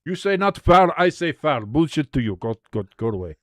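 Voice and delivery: mock Russian referee laying down the law; Russian referee accent. Commentator voices referee waving away complaint, mock Russian accent. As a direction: put on a Russian accent, dismissive. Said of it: "I make all referees sound Russian".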